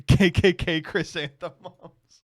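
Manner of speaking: K K K stammered while laughing, slow; laughing stutter. Retold or imitated. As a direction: giggle through the K K K stammer. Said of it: "I said that for weeks".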